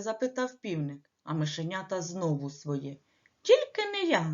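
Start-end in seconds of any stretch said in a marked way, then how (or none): 3.4-4.3 high squeak of a refusal; squeaking mouse. Acted. Mouse refuses to knead dough, high squeak. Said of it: "запищав Круть".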